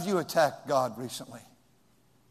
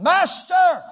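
loud, high call of alarm; panicked cry; 13 semitones higher, 10 dB louder than usual. Retold or imitated. Disciples in the storm shouting to wake Jesus.

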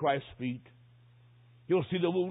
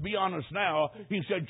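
ordinary talk

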